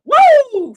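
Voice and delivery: a sudden high, loud "woo" at his own slip; high loud whoop. Real, not acted. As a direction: yelp it out in mock alarm. Whoops after Freudian slip.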